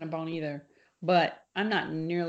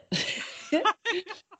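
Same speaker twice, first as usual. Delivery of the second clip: laughing; 10 semitones higher than usual. Real, not acted. Laughs at own remark.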